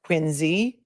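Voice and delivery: Quincy said as QUIN-zee, with a z; local pronunciation. Shown as a demonstration. Host proving she's getting it, Quincy said as "Quinzee". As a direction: say Quincy slowly with a buzzing z, rising. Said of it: "I'm getting it, you guys".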